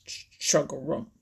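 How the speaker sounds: low gruff frog croak; deep, gruff voice. A narrator's reading. Grandfather Frog breaking into the quarrel, deep and gruff. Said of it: "in his deepest, gruffest voice".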